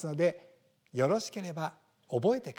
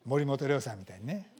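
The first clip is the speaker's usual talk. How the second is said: ordinary talk, nothing marked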